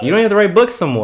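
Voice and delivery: high, fast, mocking. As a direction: toss it off, way up high and quick. Mocking a rich author.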